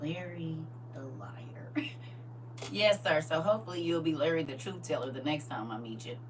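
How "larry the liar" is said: slow, hushed whisper; whispered nickname. Retold or imitated. Savoring the nickname.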